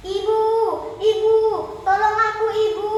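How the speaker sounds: high, loud cries for help; calling for mother. Acted. Fleeing girl calls her mother for help, crying out. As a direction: call out desperately into the distance. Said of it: "sambil memanggil nama ibunya".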